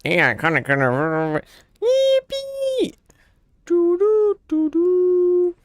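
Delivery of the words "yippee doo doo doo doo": squeaky high yippee then humming; squeaky cheer. Acted. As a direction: cheer way up, then chirp a tune.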